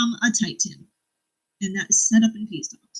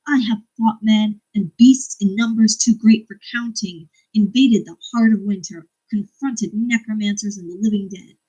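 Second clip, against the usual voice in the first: louder, slow defiant declamation; reading a book aloud; 5 dB louder than usual. Acted. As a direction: proclaim it like a challenge shouted back. Reads a battle speech.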